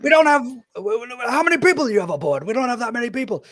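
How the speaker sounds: high, loud flustered protest; flustered fisherman. Acted. Fisherman protesting to the captain, high flustered voice. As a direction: sputter it out in alarm. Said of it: "one of them goes like".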